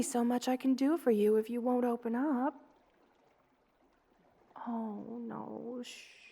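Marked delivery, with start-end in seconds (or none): 4.7-6.2 hushed soothing shh; quiet it down like calming a scared child; hushed, soothing shush; gentle shushing. Acted. Soothing a frightened listener.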